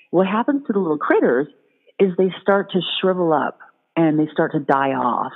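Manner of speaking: ordinary talk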